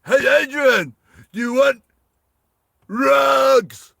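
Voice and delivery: high, loud mock holler; yelling out. Misheard climber yelling an offer to Adrian, mock shout. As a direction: holler it, stretching the last word. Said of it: "he thinks he's yelling out".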